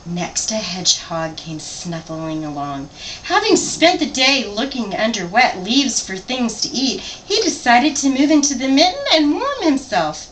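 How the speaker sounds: lively picture-book read-aloud; story narration. A narrator's reading. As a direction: read it to a child, animated.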